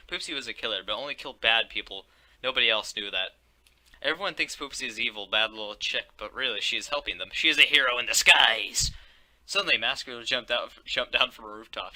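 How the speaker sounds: ordinary delivery, nothing marked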